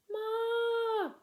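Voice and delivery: high muffled call. Acted. Trapped girl calls to Ma through the rock, high call. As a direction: cry out for mother. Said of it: "called through the rock".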